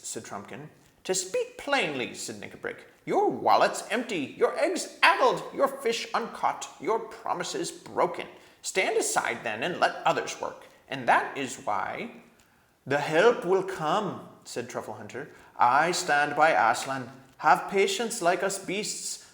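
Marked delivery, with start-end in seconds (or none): 1.0-2.1 much higher, loud furious dwarf; angry dwarf. A narrator's reading. Nickabrick snapping at the king, high and furious. 3.1-12.1 much higher, loud furious dwarf; angry dwarf. A narrator's reading. Nickabrick snapping at the king, high and furious. 12.9-19.3 calm them with patient faith; patient badger. A narrator's reading. Voices Truffle Hunter.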